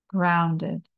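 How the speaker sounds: usual speaking voice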